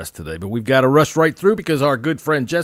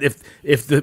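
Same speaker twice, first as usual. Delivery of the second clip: usual speaking voice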